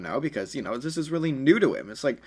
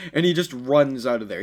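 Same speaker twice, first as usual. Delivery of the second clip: ordinary delivery, nothing marked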